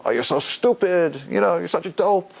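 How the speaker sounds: put-on teasing teenager voice; a teen mocking a close friend; mock teen insults. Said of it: "they say".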